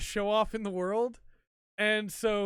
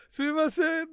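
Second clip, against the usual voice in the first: put-on girls' apology; 9 semitones higher, 6 dB louder than usual. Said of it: "the two tennis girls go".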